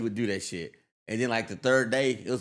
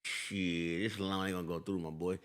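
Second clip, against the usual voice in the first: street talking banker; 6 semitones lower, 9 dB quieter than usual. Retold or imitated.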